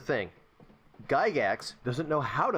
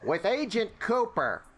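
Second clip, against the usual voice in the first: high, slow celebrity impersonation; David Lynch; 8 semitones higher, slower than usual. Retold or imitated. Player riffing on the director's character, David Lynch voice. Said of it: "he talks like David Lynch".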